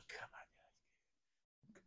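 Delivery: a hushed, breathy scoff; whisper. Retold or imitated. Scoffing back at the guru in a whisper. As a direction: whisper it in disbelief.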